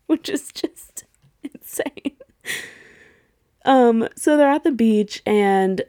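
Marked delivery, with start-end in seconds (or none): 0.1-2.2 slow, breathy laughing whisper. Real, not acted. Laughing aside to viewers about the cheap tablet. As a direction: giggle it under your breath. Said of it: "laughing whisper aside".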